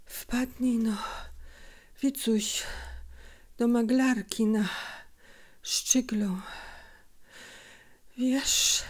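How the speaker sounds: halting breathy speech, long pauses; a sick mother gasping out a few words at a time; tired, broken voice. A narrator's reading. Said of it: "rzekła zmęczonym, przerywanym głosem".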